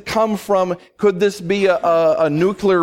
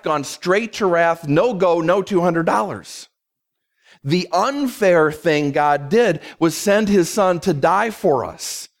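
normal talking voice

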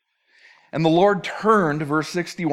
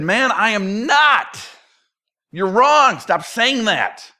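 louder, higher, slow emphatic denial; a little fired up; 6 semitones higher, 5 dB louder, slower than usual. Acted. Peter answering his second accuser, fired up. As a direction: push back hard, drawing out the words. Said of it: "Peter gets a little fired up".

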